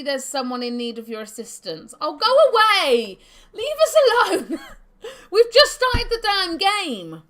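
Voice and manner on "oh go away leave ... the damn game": laughing shout of fake outrage; shouting and laughing in playful protest; mock outraged shout. Real, not acted. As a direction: yell at the game, laughing. Laughing outburst at game quest.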